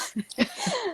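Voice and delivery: a sudden high burst of laughter. Real, not acted. Picturing herself staring blankly at a wall, high laugh. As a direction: laugh at yourself, high and light.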